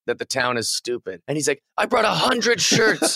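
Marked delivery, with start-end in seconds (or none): 1.8-3.2 high, drawn-out wrestler's complaint; mock yelling. Wrestler complaining the town didn't buy enough merch, mock yelling. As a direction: rant like an aggrieved heel, without full volume. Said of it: "when he's just yelling about how".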